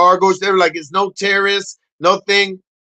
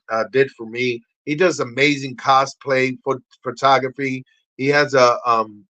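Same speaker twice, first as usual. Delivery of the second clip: ordinary delivery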